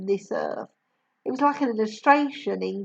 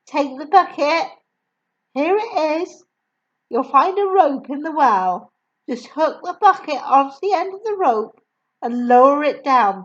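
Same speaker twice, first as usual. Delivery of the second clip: put-on higher, louder gentle young woman; kind teacher voice; 5 semitones higher, 7 dB louder than usual. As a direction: speak brightly as a kindly teacher. Miss Honey voiced.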